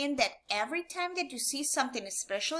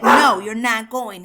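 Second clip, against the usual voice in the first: firm refusal; 12 dB louder than usual. Shown as a demonstration. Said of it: "it's not telling the person that".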